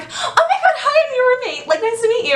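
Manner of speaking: put-on very high, giggly excited girl; bubbly girly voice. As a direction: squeal hello like a thrilled party girl. Bubbly roommate greeting.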